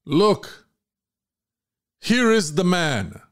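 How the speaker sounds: a lightly voiced governor calling out; Pilate presenting.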